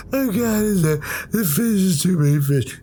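high, panicky wail; panicked crying. Retold or imitated. Kid pulling off his mask after the fish, high panicked wail. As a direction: squeal it out like a terrified kid. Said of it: "I'm crying".

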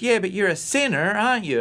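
put-on high, insistent kid-sister voice; mock big sister. Sister pressing her little brother, in a high kid voice. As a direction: pitch it way up like a pushy seven-year-old. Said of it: "And she goes".

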